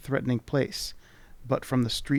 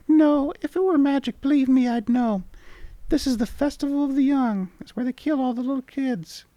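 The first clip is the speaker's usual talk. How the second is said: much higher, squeaky sprite voice; charming tiny creature; 13 semitones higher, 5 dB louder than usual. Acted. Tiny creature charmingly greeting the traveller. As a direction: chirp it like a tiny fairy. Voicing the mini mite.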